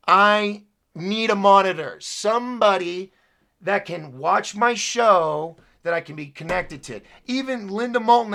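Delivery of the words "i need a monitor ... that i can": loud, drawn-out frustration after a sigh; real worked-up plea for help; exasperated demand. Real, not acted. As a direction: stretch the words out in fed-up emphasis. Fed up, demands help with show.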